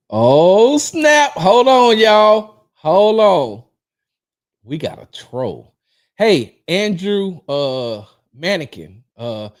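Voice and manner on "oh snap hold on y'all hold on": loud, high drawn-out holler; big alarmed "oh snap" holler; calling a halt. Real, not acted. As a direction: shout to stop everyone mid-show. Spots a troll, halts show.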